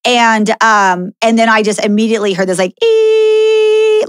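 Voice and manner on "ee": a thin, very high "eee" ringing tone; imitated ear ringing. Telling of the crash, she imitates the high ringing in her ear. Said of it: "like, in my right ear".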